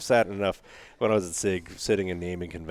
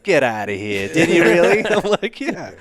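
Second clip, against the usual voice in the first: laughing; 11 semitones higher, 12 dB louder than usual. Real, not acted. Laughing over friend's reaction.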